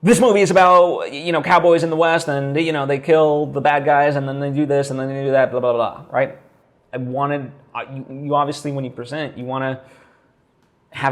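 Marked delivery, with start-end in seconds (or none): 0.0-6.0 rushed pitch. Shown as a demonstration. Showing how not to pitch a movie, rushed and too fast. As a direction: race through it without a single pause. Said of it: "it didn't go too fast. It wasn't like".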